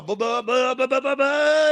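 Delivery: high-pitched sputtering motorbike revs; imitated engine noise. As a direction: mimic a bike lurching off out of control. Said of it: "going, and it's all".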